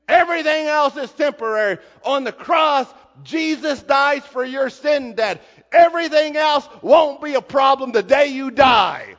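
high, loud sermon proclamation; preaching at full force. Acted. The preacher proclaiming to the congregation, loud and high. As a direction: proclaim it to the rafters.

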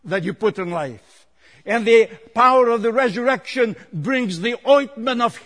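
high, projected preacher's voice; impassioned preaching. Real, not acted. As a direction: tell the Bible story from the pulpit with force. Preaches at full force.

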